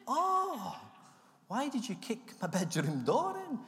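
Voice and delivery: high shocked squeal of disbelief; mock-shocked high voice; shocked Michael. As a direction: play the flatmate caught off guard.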